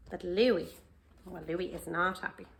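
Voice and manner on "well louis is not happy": plain reading voice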